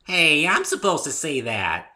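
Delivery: high, loud and slow playful tease; bouncy pony teasing her friend; teasing Pinky voice. A narrator's reading. Pinky teases the narrator who pinned her, high and playful. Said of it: "she teased".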